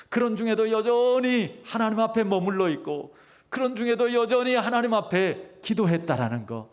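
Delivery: high, driving preacher's delivery; emphatic preaching. Real, not acted. Preacher urging congregation on Job staying before God, emphatic. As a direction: press each phrase high and insistent.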